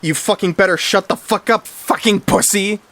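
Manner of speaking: acted bratty rage; threatening. A narrator's reading. Martha curses the hissing cat.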